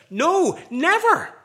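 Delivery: "No, never" high, loud and very slow; strong double negative. Retold or imitated. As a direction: lean hard on "never", drawn out. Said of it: "the original is very strong here".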